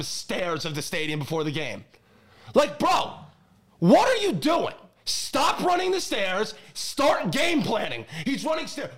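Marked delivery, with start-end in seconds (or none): 2.5-8.9 high, loud, fed-up ranting at the coach; stretched-out words in a high, loud fan rant; exasperated rant. Real, not acted. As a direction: vent like a fan who's had enough.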